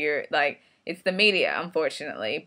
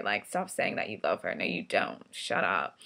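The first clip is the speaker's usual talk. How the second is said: much lower, flat brush-off voice; friend's dismissive retort; 9 semitones lower, 4 dB quieter than usual. Retold or imitated. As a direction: shut her down with a low, curt put-down. Friend Sandy snaps at the girl.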